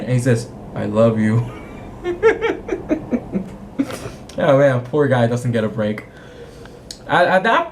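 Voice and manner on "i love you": laughing. Real, not acted. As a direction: say it and crack up. Retelling line, breaks laughing.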